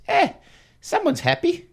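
high-pitched, hammed-up Australian accent; bad Australian accent. Host retelling the ad's joke, bad Australian accent. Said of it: "That's a horrible accent".

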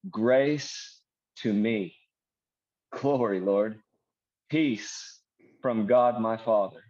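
slow, deliberate phrases with long pauses; slowed-down prayer. Shown as a demonstration. Preacher turning the verse into personal prayer, slowly. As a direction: pray it slowly, pausing after each phrase. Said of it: "I'm gonna slow it down and say".

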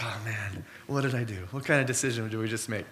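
second-guessing himself under his breath; quiet dismay. Retold or imitated. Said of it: "I'm like".

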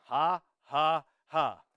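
loud, mocking ha ha ha; scoffing laugh. Preacher mocking the heaven on earth idea, scoffing laugh.

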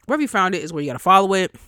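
normal talking voice

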